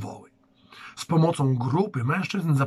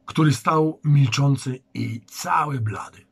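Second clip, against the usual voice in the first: usual speaking voice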